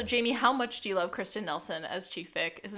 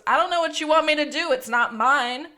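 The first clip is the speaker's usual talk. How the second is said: quick, louder, higher-pitched retort; exasperated judge; 6 semitones higher, 7 dB louder than usual. Acted. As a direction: throw up your hands as a fed-up judge. Acting out exasperated judge's quip.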